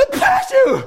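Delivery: sudden high, loud sneeze. Acted. Character sneezes hard after the pinch of snuff, loud and high.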